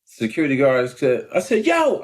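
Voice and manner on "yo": high-pitched hail; retold calling out. Hailing the security guard too, retold high call. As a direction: call out to get attention. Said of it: "I called the security guard too".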